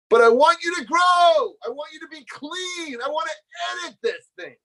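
high, straining cry of care; impassioned plea. Retold or imitated. Rabbi pleading with his student to grow, impassioned.

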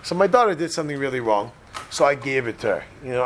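usual speaking voice

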